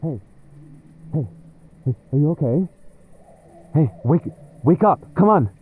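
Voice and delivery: rising alarm, pleading; frantic wake-up. Acted. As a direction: shake them awake, scared. Partner unconscious, frantic wake-up.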